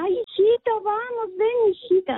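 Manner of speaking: high sweet coaxing voice, far above her own; sweetly coaxing a little girl to come along; sugary grandparent calling the child. Retold or imitated.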